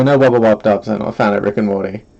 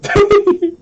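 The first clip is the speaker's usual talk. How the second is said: burst of laughter; 5 dB louder than usual. Real, not acted. Laughing at own attempt.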